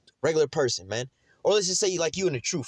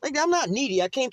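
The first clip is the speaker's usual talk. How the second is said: indignant protest; 11 semitones higher than usual. Real, not acted. Indignant reply to clerk.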